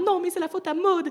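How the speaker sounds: high whiny protest; blaming minion. Acted. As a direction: pass the blame in a high voice. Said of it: "tu vas faire".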